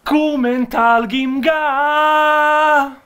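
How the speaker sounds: Kumental Gimga slowly stretched, high and loud; Maltese attempt. Shown as a demonstration. Presenter announcing comment of the week in mock Maltese, booming. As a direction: boom out Kumental Gimga like a big reveal. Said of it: "we're gonna say it in Maltese".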